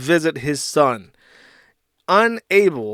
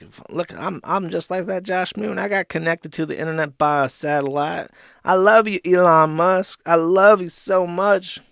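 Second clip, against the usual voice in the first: mock Ralph voice; 4 semitones higher than usual. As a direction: ham up a drunk fan gushing over Elon. Mocking Ralph's voice, Starlink.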